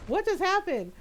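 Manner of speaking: can't believe what you just saw; stunned disbelief. Retold or imitated. Shocked after mom's switch.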